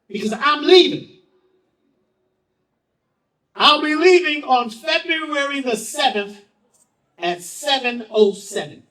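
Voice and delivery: mother's declaration. Retold or imitated. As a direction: announce it like a mother certain of her fate.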